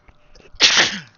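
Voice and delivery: loud sneeze; sudden sneeze into the mic; sneezing. Real, not acted. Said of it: "Sorry for the sneeze".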